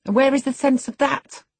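high, loud, cross question; peevish. A narrator's reading. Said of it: "in a peevish tone".

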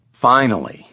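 ordinary delivery

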